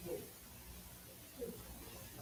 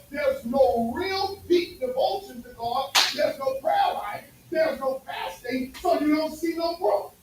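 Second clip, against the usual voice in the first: slow emphatic preaching; 3 semitones higher, 3 dB louder, slower than usual. Real, not acted. Preaching build.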